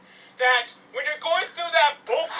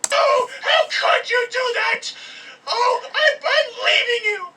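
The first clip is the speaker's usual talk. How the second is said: high, loud mock sobbing breakup; hammed-up crying girlfriend voice; mock girlfriend wail; 7 semitones higher, 3 dB louder than usual. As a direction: wail like a dramatic girlfriend leaving.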